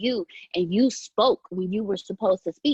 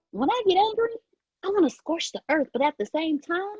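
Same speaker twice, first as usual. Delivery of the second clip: high chipmunk voice; 7 semitones higher than usual. Real, not acted. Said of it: "this chipmunk voice".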